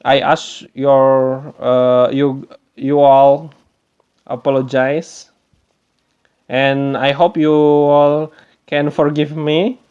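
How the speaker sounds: usual speaking voice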